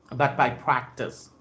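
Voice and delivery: ordinary talk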